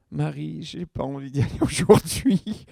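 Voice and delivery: mock reluctant moan, breaking into a laugh; reluctant whining. Pastor confessing to his wife he won't go, mock reluctant. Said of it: "de dire à ma femme".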